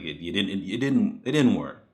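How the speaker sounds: ordinary talk, nothing marked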